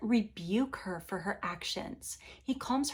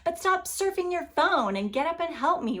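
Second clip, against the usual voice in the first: high, loud exasperated call for help; annoyed plea; 9 semitones higher, 4 dB louder than usual. Retold or imitated. Fed-up helper calls on a lazy household, exasperated. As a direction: pitch it up like a fed-up worker calling for help. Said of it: "And you're like".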